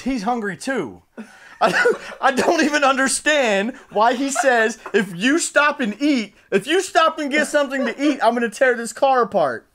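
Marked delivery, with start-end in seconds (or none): 4.9-9.7 higher, insistent retold threat; dead serious threat. Billy threatening anyone who stops to eat, mock serious. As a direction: imitate a friend laying down an ultimatum. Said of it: "He's dead fucking serious too".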